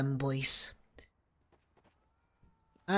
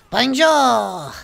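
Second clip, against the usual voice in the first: Bonjour shouted higher in a hammy French voice; 4 semitones higher, 9 dB louder than usual. Acted. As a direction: belt out Bonjour in a put-on French voice. Greets the French Scout again.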